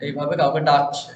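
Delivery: usual speaking voice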